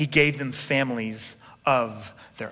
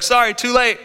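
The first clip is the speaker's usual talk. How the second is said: mock-sassy, louder quip; sassy retort; 8 semitones higher, 5 dB louder than usual. Midwife brushing off the Pharaoh, sassy quip. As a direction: toss it off like a brush-off. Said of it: "and she's like".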